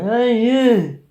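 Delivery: high, loud drawn-out heckle; obnoxious Finn yelling. Retold or imitated. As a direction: holler at strangers like an obnoxious drunk pest. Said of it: "this rather obnoxious Finn went".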